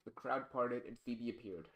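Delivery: plain reading voice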